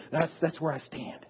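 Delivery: ordinary delivery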